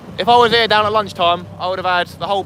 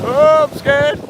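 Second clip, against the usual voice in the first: high, louder mock fright; mock scared; 8 semitones higher, 4 dB louder, much slower than usual. Speeding in his boat, hammed-up fright in a high voice. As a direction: play-act being frightened.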